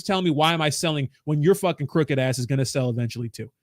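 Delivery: usual speaking voice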